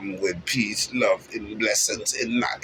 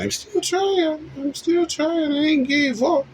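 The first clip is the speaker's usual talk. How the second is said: high squeaky voice. Acted. Insisting to the listener he's still trying, way up high.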